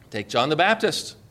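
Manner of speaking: ordinary talk, nothing marked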